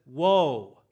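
booming woe, fading away on the last one; God pronouncing judgment, loud woes that fade; God's woe cry. Acted. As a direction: call out the woes like thunder, then let them die. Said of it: "he says".

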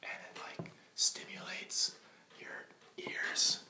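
unvoiced ASMR whisper. Shown as a demonstration. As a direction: breathe it like an ASMR video. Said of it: "talk really quietly into their microphone".

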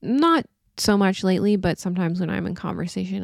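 usual speaking voice

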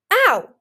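sudden high, loud yelp of pain; loud yelped "ow" in protest. A narrator's reading. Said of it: "Clearsight protested".

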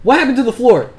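loud, high alarmed question; high-pitched, shouted disbelief; shocked shout. Acted. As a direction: cry out in shock at the damage. Guards react to the wrecked floor.

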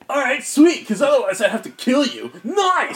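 high, loud, stoked bro creature; Fugamon. Acted. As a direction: exclaim it like a delighted dude. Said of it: "and Fugamon will answer".

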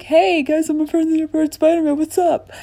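fast, high, lightly voiced superhero; chirpy hero greeting, quick and high; Spider-Man voice. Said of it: "Spider-Man would be like".

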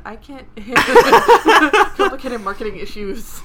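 laughing loudly through words. Real, not acted. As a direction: laugh it out slowly. Laughing repeat of the line.

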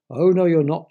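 loud, high chiming refusal; chimed objection. A narrator's reading. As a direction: chime in forcefully to forbid it. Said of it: "chimed the ears".

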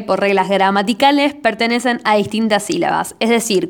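usual speaking voice

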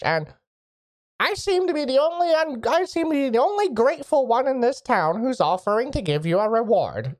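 a high, effusive, eager local mage; eager mage Artemis. Acted. Mage Artemis greets the strangers and offers a reward, eager and high. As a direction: gush a welcome over the strangers.

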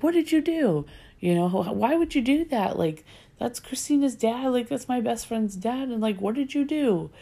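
high-pitched, incredulous retold outburst; retold panicked protest. Confronting sister who told Dad about friend's dad, incredulous.

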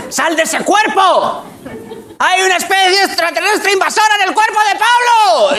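high, frantic mock alarm; panicked mother. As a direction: shriek like a terrified mum. Mother exorcising her son. (madre aterrada que pide ayuda a gritos)

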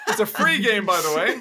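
laughing. Real, not acted. Laughing while explaining game.

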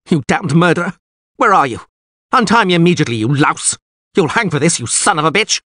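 high, loud furious outburst; enraged cursing. A narrator's reading. Bound lord demands to be untied, cursing furiously. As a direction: rail at your captor. Tied captive cursing his captor.

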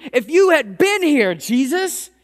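level the blame at him, voice lifted; acted reproach, pushing the opening hard; accusing. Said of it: "they even accuse him".

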